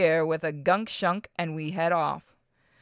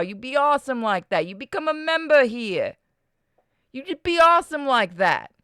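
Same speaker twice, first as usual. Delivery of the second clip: higher, hammed-up New York wiseguy pitch; mock New York mobster sales patter; 5 semitones higher than usual. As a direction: shill it in a put-on New York accent. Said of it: "stuck in that fucking voice".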